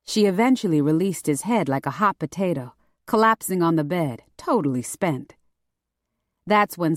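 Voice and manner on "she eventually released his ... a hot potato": usual speaking voice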